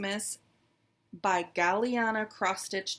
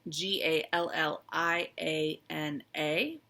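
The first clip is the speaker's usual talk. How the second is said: spelled out; 2 semitones lower, slower than usual. Shown as a demonstration. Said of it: "spelling out group name".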